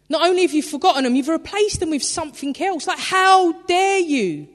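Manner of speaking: God rebuking his people, growing furious; raging God. Retold or imitated. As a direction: let the anger build until how dare you. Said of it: "He was raging".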